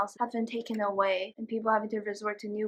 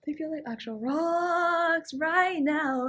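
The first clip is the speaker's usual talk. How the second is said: slow whiny complaint; 5 semitones higher, much slower than usual. Real, not acted. Whining about numb feet.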